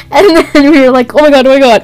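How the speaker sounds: high, loud, laughing almost to tears; laughing hard. Real, not acted. Cracking up with her bestie over the jinx, laughing hard. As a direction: laugh through it, barely holding on.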